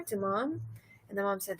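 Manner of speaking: usual speaking voice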